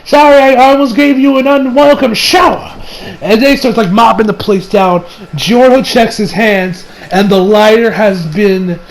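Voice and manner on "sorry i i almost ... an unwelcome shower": higher-pitched put-on old man voice; old-timer calling out an apology; old guy. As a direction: play an apologetic old fellow. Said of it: "it's the old guy who's like".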